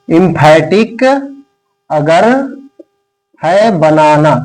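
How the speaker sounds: एम्फैटिक, अगर, है बनाना said word by word with long pauses; slow dictation. Shown as a demonstration. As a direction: dictate each chunk slowly so students can write. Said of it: "मैं ये poem इसलिए लिखवा रहा हूं".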